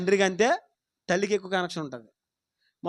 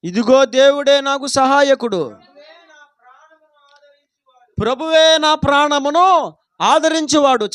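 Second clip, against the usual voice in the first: loud, slow proclaiming of scripture; preaching at full force; 5 semitones higher, 11 dB louder than usual. Acted. Preacher to his congregation, proclaiming the psalm loudly. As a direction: preach it out to the whole hall.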